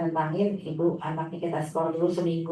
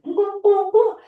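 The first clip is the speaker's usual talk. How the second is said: put-on much higher, louder childish wail of refusal; child's protest; 14 semitones higher, 9 dB louder, faster than usual. Teacher mimicking the boy refusing, high loud childish protest. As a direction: cry out no like a stubborn kid.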